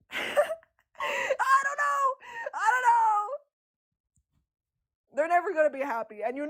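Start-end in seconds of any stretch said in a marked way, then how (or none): 0.1-3.3 very high, drawn-out helpless cry; laughing wail. Real, not acted. Streamer answering a viewer's challenge, high laughing wail.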